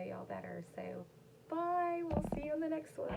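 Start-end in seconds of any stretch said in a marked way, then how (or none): 1.5-3.1 high, bright mock sign-off; playful high goodbye. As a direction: sing out a cheeky farewell.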